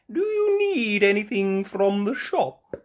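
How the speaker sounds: put-on sweet old grandma asking; Granny Pig voice. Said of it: "Granny Pig asked".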